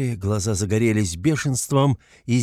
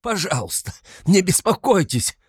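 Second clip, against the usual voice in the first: raised, high-pitched exclamation; agitated cry; 9 semitones higher, 3 dB louder than usual. A narrator's reading. Raskolnikov waving off the investigator's concern, agitated cry. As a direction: burst out, swelling louder on the last words. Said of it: "вскричал Раскольников и вдруг захохотал".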